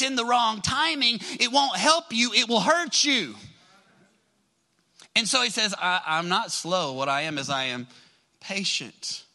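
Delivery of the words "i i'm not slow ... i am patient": low, settling reassurance; calm, patient. Acted. Voicing God's patient answer.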